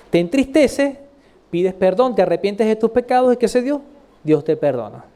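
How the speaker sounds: ordinary delivery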